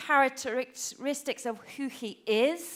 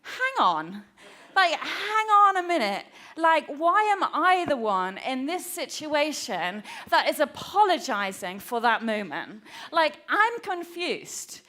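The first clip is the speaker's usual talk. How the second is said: mock-indignant protest, mostly higher; indignant confusion; 3 semitones higher than usual. Mum replaying her child's accusation, indignant and confused. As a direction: protest with emphatic, swooping stresses. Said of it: "I was like".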